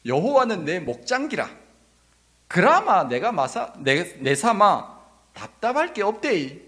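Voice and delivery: loud, high-swinging Gyeongsang dialect. Shown as a demonstration. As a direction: hammer out a Gyeongsang dialect impression. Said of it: "경상도 버전으로 하면". (크고 억양 센 경상도 사투리 흉내)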